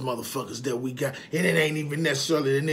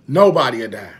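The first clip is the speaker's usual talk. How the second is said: "Nobody'll die" said much louder, hammered; loud emphatic; 2 semitones lower, 11 dB louder than usual. Real, not acted. Man preaching to viewers, "Nobody'll die" loud and emphatic. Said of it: "repeated louder".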